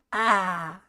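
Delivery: "Ah." with the tongue out, a bit bright; saying ahh. Shown as a demonstration. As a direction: tries "Ah." with the tongue stuck out. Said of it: "Do you wanna give it a try?".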